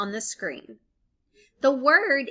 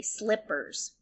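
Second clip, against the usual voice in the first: usual speaking voice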